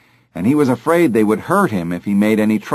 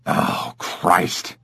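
exasperated thug; 5 semitones lower, 4 dB quieter than usual. Acted. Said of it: "exactly duplicating his voice".

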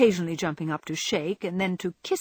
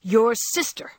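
loud, higher, proud announcement; loud solemn excitement; 4 semitones higher, 6 dB louder, slower than usual. A narrator's reading. Jo revealing who wrote the story, in a loud voice. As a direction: declare it loudly, solemn yet excited. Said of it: "replied in a loud voice".

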